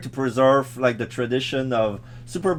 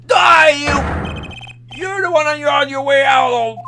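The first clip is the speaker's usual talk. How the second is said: shrill villain shout; 11 semitones higher than usual. Acted. Villain threat in high voice.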